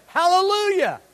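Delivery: high, loud praise cry; exuberant hallelujah. Shown as a demonstration. Preacher showing how hallelujah should sound, high joyful cry. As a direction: lift the word up joyfully. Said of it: "how can you say that word".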